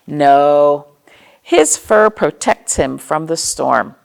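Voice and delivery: gentle picture-book narration with playful questions; reading to children. Acted. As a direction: read it like story time for little kids.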